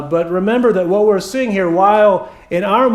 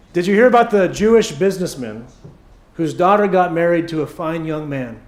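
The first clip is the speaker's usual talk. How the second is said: ordinary talk, nothing marked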